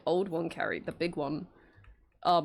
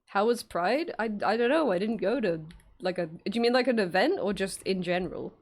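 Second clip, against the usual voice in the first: normal talking voice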